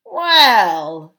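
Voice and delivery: loud, drawn-out dwarf opener; a heavy, scornful dwarf voice; dwarf leader weighing in loudly. A narrator's reading.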